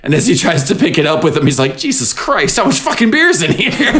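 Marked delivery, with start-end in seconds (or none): 1.8-3.9 strained disbelief. Retold or imitated. Oliver straining under the cooler.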